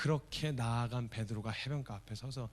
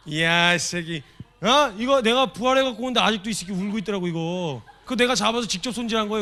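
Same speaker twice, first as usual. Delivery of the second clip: loud, high, hammed-up rant at an old friend; mock furious scolding; 6 semitones higher, 12 dB louder than usual. Jesus mock-yelling at Peter over the rooster. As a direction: roar it like an outraged buddy.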